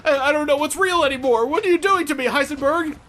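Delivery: high panicked whine; mock panic. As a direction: pretend terror, voice way up.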